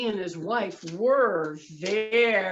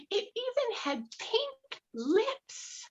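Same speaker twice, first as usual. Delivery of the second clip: amazed; slower than usual. Acted. Describing amazing fish.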